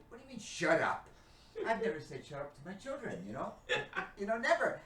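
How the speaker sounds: mock indignation. Retold indignant reply.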